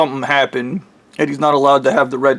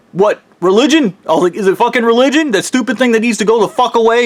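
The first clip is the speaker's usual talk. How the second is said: sharply higher, incredulous mock outrage; pissed off; 7 semitones higher, faster than usual. Dreamer challenging the god about religion, incredulous and raised. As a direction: spit the question out in disbelief. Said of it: "And I got pissed at him".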